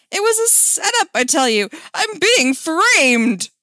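loud, high theatrical protest; high outraged shout, dragging out the last words. Acted. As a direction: shout your innocence, hammed up. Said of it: "jumped to his feet and shouted".

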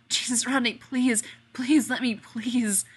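loud high pleading; beg, desperate and strained; desperate begging. Acted.

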